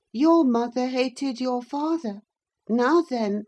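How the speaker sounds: put-on high, sly boy's jibe; high spiteful boy taunting; taunting sickly boy. A narrator's reading. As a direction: needle her with it. Linton voiced taunting.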